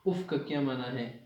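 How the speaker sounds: ordinary delivery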